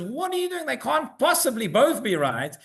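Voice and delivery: a rebbetzin scolding her husband in disbelief; Rebbetzin's protest. Retold or imitated. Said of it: "she turns to the rabbi and says".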